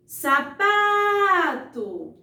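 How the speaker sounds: "Sapato" called out loud, PA held very long; calling from afar. Shown as a demonstration. Teacher calls a faraway word to find its stressed syllable, "Sapato" with long pa. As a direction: call "Sapato" as if it were far away, stretching pa. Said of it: "chamar a palavra como se ela estivesse bem longe".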